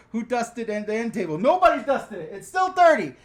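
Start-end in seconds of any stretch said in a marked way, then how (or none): 2.3-3.1 louder and higher, pressing the point; still dirty. Real, not acted. Telling viewers the table is still dirty, raised voice. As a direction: insist it's dirty, voice up.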